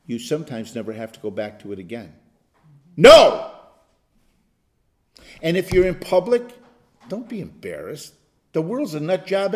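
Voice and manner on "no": one sharp loud refusal; shouted no. Shown as a demonstration. Pastor showing the congregation a convinced no, sudden loud shout. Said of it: "say it with conviction".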